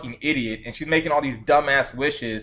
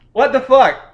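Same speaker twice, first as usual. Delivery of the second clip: exasperated outburst; 8 semitones higher, 6 dB louder than usual. Real, not acted. Exasperated outburst at dumb heroine.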